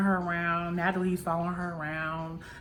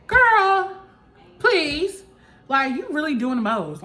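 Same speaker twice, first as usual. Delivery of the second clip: high, loud dismissive outburst; loud, incredulous brush-off; exasperated scoff; 9 semitones higher, 8 dB louder than usual. Real, not acted. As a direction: drag out the first words, fed up.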